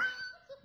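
real laugh breaking out; burst of high laughter. Real, not acted. Laughs after quote.

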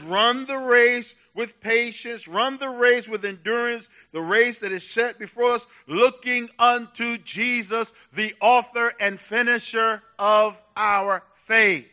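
slow, loud, ringing scripture quote; full-force preaching. Acted. Pastor to his church, quoting scripture in full-force preaching. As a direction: drive each phrase home to the congregation.

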